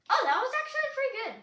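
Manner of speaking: fast, high burst of delight; thrilled the plane flew well; real happy surprise; excited. Real, not acted. Paper plane flight goes well.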